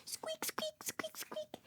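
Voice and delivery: tiny, high, very soft squeaks; mouse squeaks. Pretend. Teacher pointing out two little mice to a child, tiny high squeaking. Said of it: "I see two little mice".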